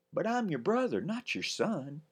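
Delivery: earnest little brother; boy protesting. Acted. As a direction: correct her like a serious little boy. Ben correcting her.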